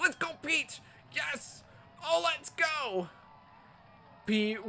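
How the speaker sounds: high, loud cheering yell; excited cheering. Real, not acted. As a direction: cheer him on at full voice. Cheering teammate's big play.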